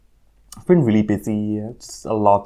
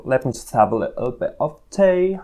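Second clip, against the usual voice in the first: select some tea in a playful mock-British accent; 8 semitones higher than usual. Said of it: "I don't know why I'm so British".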